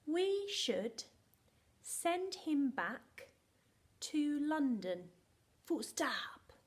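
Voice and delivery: slow, loud, evenly chunked words; say-along dictation. Shown as a demonstration. Teacher asks pupils to say it along, slow and emphatic. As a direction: lead a class chanting it word by word. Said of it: "Can you say that with me?".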